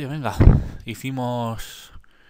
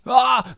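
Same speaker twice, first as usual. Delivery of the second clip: high, loud "ah!" of frustration; sudden high yelp on losing; real startled gamer yell; frustrated yell; 12 semitones higher, 8 dB louder than usual. Real, not acted. Player fails during the game.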